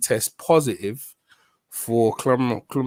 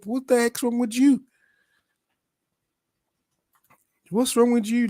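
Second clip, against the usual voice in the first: exasperated; 10 semitones higher than usual. Real, not acted. Outraged at Eubank.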